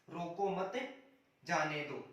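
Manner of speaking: "रोको मत" together, a break, then "जाने दो"; pause after मत. Shown as a demonstration. Teacher reading out a punctuation example, pause after मत. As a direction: break the phrase after "मत", let "जाने दो" fall. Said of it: "इसमें दो प्रकार की स्थितियां".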